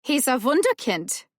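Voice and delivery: mock German accent, playful. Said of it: "adopting a German accent all of a sudden".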